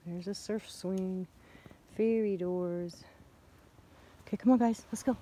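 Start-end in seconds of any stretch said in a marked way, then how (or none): 4.3-5.2 calling the dogs. Real, not acted. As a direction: call the dogs along the trail.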